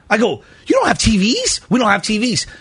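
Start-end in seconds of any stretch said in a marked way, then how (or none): 0.7-1.6 higher, rising disbelief; retold disbelieving query, pitch up at the end; incredulous question. Retold exchange, he queries the answer. 1.7-2.5 plain falling statement; retold unbothered reply, falling at the end; matter-of-fact answer. Retold exchange, staff answer him.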